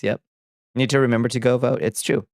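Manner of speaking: usual speaking voice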